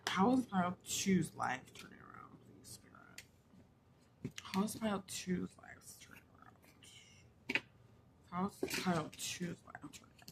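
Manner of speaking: hushed whisper to the spirits. Real, not acted. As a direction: whisper the question reverently.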